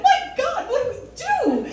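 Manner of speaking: high-pitched panicked plea to the psychic; panicked squeal. Retold or imitated. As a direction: shriek it like your younger self in a panic. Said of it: "I'm like".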